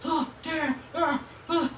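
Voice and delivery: put-on high whiny kid voice; high mock whine of an annoyed son. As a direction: imitate a sulky ten-year-old. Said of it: "he starts getting mad".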